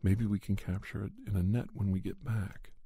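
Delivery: ordinary delivery, nothing marked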